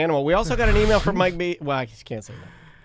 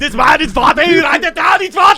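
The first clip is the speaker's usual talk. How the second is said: high, loud joking yell; shouting; 11 semitones higher, 10 dB louder than usual. Real, not acted. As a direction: shout out the accusation. Group chanting write it down.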